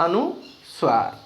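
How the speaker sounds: अनुस्वार stretched slowly, falling at the end; drawn-out demo. Shown as a demonstration. Teacher drilling the term अनुस्वार for pupils, slowly. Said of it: "क्या कहते हैं जी सही व्याकरण में".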